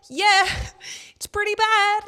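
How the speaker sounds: loud, high-pitched wail of agreement; high, loud, half-laughing outburst; incredulous laughing reaction. Retold or imitated. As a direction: exclaim it loudly at the absurd irony. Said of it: "I was, like, laughing".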